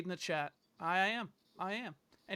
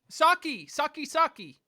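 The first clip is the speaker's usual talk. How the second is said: loud and clear; 8 semitones higher, 7 dB louder than usual. Real, not acted. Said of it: "am I coming through loud and clear".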